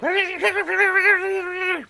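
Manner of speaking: helium-high fast-forward voice; sped-up voice. Shown as a demonstration. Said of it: "that's my imitation of the high-speed, sped-up voice sound thing".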